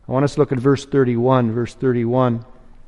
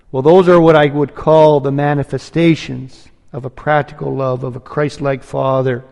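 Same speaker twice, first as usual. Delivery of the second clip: normal talking voice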